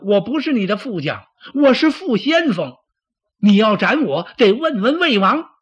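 high, loud, defiant retort; defiant protest. Acted. Deputy threatened with beheading defies the general, defiant. Acted quarrel between two generals.

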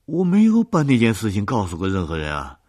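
high, loud panicked pleading; frightened man begging to be believed; terrified. A narrator's reading. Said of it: "他惊恐地说".

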